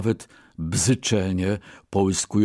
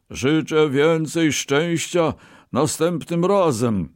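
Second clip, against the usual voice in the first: high, drawn-out teasing call; joking; 7 semitones higher than usual. A narrator's reading. Merchant paid his winnings, joking at the loser. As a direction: tease the loser with mock good wishes. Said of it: "zażartował".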